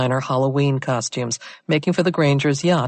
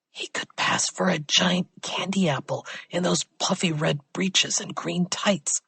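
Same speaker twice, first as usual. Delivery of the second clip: whisper; 4 semitones higher, 5 dB quieter than usual. A narrator's reading. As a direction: whisper a catty aside to him. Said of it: "Claudia whispered to Jovanich".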